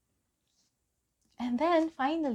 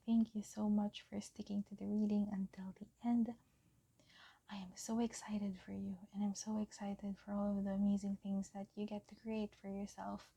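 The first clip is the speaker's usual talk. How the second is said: soft whispered thanks, softer still at the end; 5 dB quieter than usual. Real, not acted. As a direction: whisper your thanks to the viewers. Soft sign-off to viewers.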